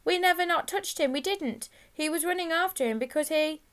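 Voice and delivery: high-voiced, anxious schoolboy explanation; hurried schoolboy excuse. A narrator's reading. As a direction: blurt out a cover story as a nervous boy. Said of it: "said Whippet very quickly".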